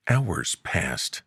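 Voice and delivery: ordinary delivery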